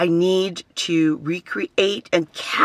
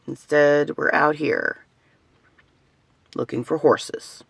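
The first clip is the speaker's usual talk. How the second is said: ordinary delivery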